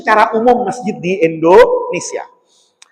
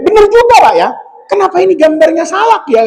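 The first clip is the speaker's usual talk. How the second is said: surprised; 10 semitones higher than usual. Retold or imitated. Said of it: "kata saya".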